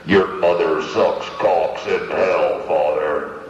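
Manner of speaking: deep, slow demonic growl; possessed girl. Acted. Possessed girl to the priest, deep demonic voice. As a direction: snarl it like the devil inside her. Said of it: "she goes".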